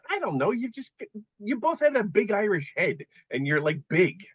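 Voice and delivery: imitating wife. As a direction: play the wife stating the obvious. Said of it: "She goes".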